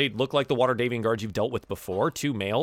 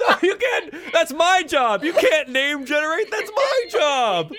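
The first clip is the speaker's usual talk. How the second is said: laughing protest; 5 dB louder than usual. Real, not acted.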